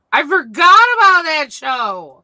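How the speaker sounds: loud, high burst of recognition; excited. Real, not acted. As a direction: blurt it out, thrilled to remember. Sudden memory of old show.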